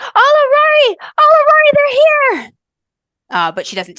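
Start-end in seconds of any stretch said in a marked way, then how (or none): 0.0-2.5 high, loud, ecstatic shouting across the sea; girl screaming with joy from the bow; joyful scream. Acted. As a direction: scream it out in wonder at the sight. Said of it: "Aoife just screams".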